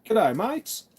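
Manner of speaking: high, swooping Australian greeting; attempted Australian accent. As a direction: try a cheery hello in an amateur Australian accent. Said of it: "do an Australian accent".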